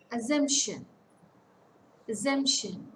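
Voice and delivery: Assumption with SUMP punched, then falling; stress on middle syllable. Shown as a demonstration. Teacher repeating the example, middle syllable stressed. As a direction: hit SUMP in Assumption each time. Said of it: "Stress falls on the middle syllable".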